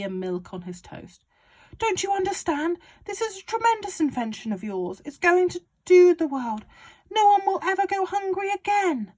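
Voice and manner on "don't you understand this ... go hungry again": high, loud, gleeful father; excited Mister Cranky. Acted. Father urging George on about the medicine, high and loud. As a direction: crow it like a man with a scheme.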